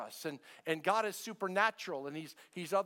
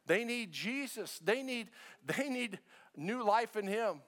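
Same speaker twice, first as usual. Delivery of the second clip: They need Jesus, said higher and slow; preached; 6 semitones higher than usual. Acted. Pastor preaching to the church about neighbors, emphatic. Preached then repeated hushed.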